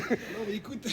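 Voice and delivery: laughing. Real, not acted. Caught, bursts out laughing.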